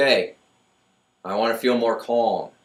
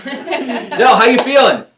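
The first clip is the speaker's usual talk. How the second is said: high, loud laughing insistence; insistent cheer; 11 semitones higher, 7 dB louder, slower than usual. Retold or imitated. Carl pressing Tim for a better answer, laughing and loud. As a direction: push him to answer with a grin. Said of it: "he's like".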